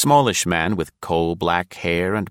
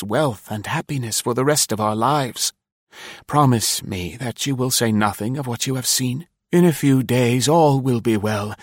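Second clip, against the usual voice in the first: higher-pitched, persuasive promise; exclaiming eagerly; 6 semitones higher than usual. A narrator's reading. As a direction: coax her with mild excitement. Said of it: "he exclaimed".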